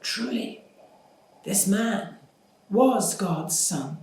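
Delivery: high, hushed start, then slow; awestruck, barely believing what they saw; terrified awe. Acted. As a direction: centurion gripped by fear and awe. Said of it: "they were terrified and said".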